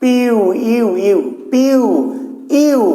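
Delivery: পিউ and ইউ each one gliding syllable; joined diphthong. Shown as a demonstration. Teacher showing students what we really say, vowels joined. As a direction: run ই straight into উ in পিউ, ইউ. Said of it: "কি বলছি আমরা".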